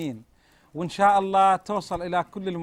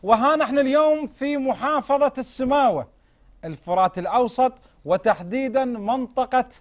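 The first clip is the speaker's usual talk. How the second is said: bright, raised presenter voice welcoming viewers; TV host intro; 3 semitones higher, 3 dB louder than usual. Acted. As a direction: open the show like a TV host. Host opens the TV episode. (مقدم برنامج تلفزيوني يرحب بالمشاهدين)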